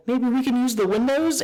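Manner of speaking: high, hammed-up timid question; mock hopeful goon. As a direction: squeak it out like a nervous thug. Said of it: "And then they go".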